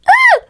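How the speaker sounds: sudden high shriek of disgust; shocked shriek. Acted. Horrified at the viewer's gums, a high shriek. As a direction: yelp like she saw the gums.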